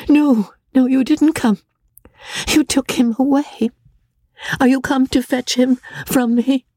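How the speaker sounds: put-on high pleading voice, distraught young widow; Amelia distraught. A narrator's reading. Grieving Amelia speaks to Rebecca, high and distraught. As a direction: she begs the ghost not to take him.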